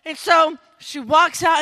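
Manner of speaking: usual speaking voice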